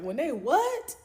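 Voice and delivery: high, loud disbelieving question; high loud shocked question. Retold or imitated. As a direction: ask it as if you can't believe it.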